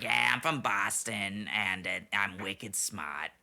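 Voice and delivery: mock Bostonian, drawn out at the end; hammy Boston accent; bad Boston accent. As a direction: do a poor imitation of Boston talk. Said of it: "not even a good example of talking like".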